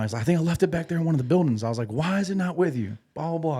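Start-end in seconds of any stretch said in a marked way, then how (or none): 0.2-1.6 high, fast, flustered rush; imitating a panicking young marine; mock panicked marine. Young marine panicking to his team leader, fast and flustered. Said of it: "he started freaking out". 1.9-3.6 chewing him out. Retold or imitated. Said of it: "I started getting into his ass".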